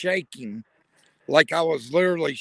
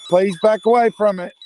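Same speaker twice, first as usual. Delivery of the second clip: louder, higher, insistent order; loud command; 6 semitones higher, 8 dB louder than usual. Real, not acted. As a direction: push the words out with force.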